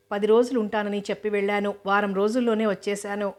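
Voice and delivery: usual speaking voice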